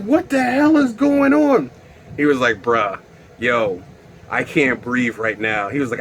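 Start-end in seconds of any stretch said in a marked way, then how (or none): 0.0-1.7 high-pitched freaked-out question; alarmed bewilderment. Acted. Storyteller finds his pants off, high alarmed bewilderment. 2.6-5.7 friend's voice. Retold or imitated. Acts friend replying, lower voice.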